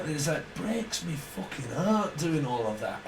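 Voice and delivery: ordinary talk, nothing marked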